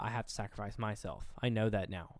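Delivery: low, soft imitation of pleading; subdued, murmured begging; retold begging. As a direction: plead quietly, understated. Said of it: "down the stairs begging".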